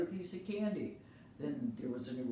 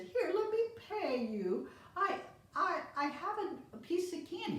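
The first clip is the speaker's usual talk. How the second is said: put-on sweet old lady; 14 semitones higher, 4 dB louder than usual. Old lady thanks the boy, very high and sweet. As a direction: coo at the helpful boy.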